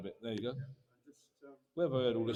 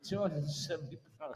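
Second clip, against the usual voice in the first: laughing through the bid call; chuckling as he repeats the price, softer; can't hold back a laugh mid-call; 4 dB quieter than usual. Real, not acted. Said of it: "we can laugh".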